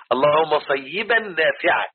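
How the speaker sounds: slow "say-yi-ban" on صيبا; corrected pronunciation. Shown as a demonstration. Teacher repeating the corrected prayer for the group, slow careful pronunciation. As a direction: lean on the doubled ya in صيبا, "sayyiban". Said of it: "غلط".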